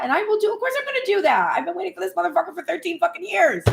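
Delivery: high, fast exasperated rant; exasperated whine. Acted. Acting out impatient desperate praying self.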